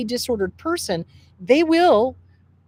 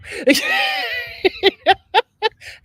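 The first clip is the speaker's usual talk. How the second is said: loud, high-pitched cackle; high laughing outburst; 13 semitones higher, 3 dB louder than usual. Acted. Reacting to therapist being right, high loud laugh. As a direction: burst into a high, wailing laugh. Pissed at therapist, then bursts out.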